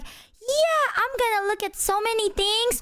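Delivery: high-pitched excited traveler; mock eagerness. Retelling keenness before the road trip, excitedly. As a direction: imitate bursting enthusiasm, voice way up.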